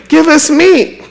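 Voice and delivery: high, pleading whine, lightly hammed; a crowd begging for meat; mock wailing crowd. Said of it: "They keep wailing".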